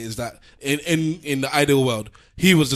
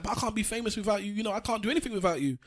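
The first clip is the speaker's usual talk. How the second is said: put-on old actress; 8 semitones higher, 7 dB quieter than usual. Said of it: "He's like".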